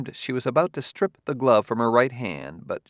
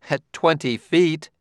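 a higher, dismissive jab; higher, contemptuous retort; 6 semitones higher, 4 dB louder than usual. Acted. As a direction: toss it off with contempt. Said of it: "she said contemptuously".

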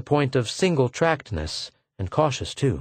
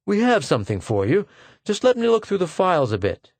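higher-pitched, coaxing smile in the voice; smiling reassurance; 7 semitones higher than usual. A narrator's reading. Smiling personnel man stalling a wary applicant, coaxing. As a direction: coax lightly, with a smile. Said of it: "He was smiling".

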